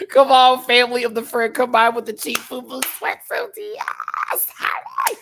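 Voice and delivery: high, loud and thrilled; excited. Real, not acted. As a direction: squeal with delight. Excited about cheap sweatsuit.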